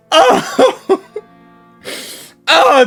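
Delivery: usual speaking voice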